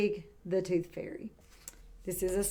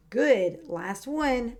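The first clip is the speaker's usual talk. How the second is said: ordinary talk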